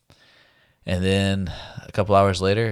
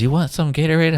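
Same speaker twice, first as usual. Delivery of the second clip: a higher, brighter-pitched hint of the wife; light wife voice; 9 semitones higher, 6 dB louder than usual. Retelling the wife offering Gatorade, voiced lightly higher. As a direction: lightly mimic her kind offer.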